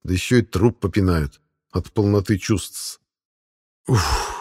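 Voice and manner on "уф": short, lower puff of air; winded oof. Acted. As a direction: let the breath burst out under a sudden weight. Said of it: "Воздух вылетает из глотки". (выдох «уф», как от удара)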